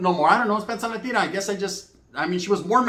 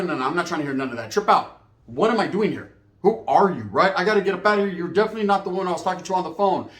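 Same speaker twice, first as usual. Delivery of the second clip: usual speaking voice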